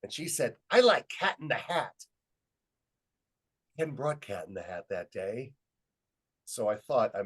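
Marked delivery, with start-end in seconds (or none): 0.7-2.0 put-on high, eager little girl's voice; a small child announcing her favorite. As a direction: pipe up like a kindergartner, high and louder. Voicing little girl answering him.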